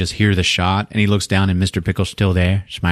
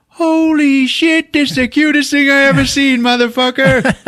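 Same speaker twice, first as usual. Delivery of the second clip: high, loud falsetto squeal over a puppy; gushing squeal; 4 dB louder than usual. Retold or imitated. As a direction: squeal at the cutest dog ever.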